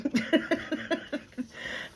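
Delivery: high breathy laugh; hoarse real laugh; raspy laugh. Real, not acted. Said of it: "I couldn't laugh like that".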